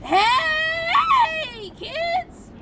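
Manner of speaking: very high, cracking elf squeal; christmas elf voice. Acted. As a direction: shout to the kids like a giddy elf. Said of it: "practicing my elf voice all year".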